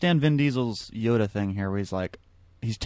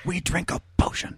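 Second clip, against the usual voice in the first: hushed, higher mock confession; secretive confiding; 5 semitones higher, 6 dB quieter than usual. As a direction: lean in and share a secret softly. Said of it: "leaned in real close and told me the secret".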